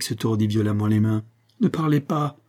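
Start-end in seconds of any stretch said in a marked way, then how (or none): 1.6-2.4 higher, pleading wail, mildly voiced; pained moan. A narrator's reading. Monique begs madame to stop speaking, pained moan. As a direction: moan it in anguish, pitch lifted. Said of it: "gémit-elle avec l'accent d'une douleur intolérable".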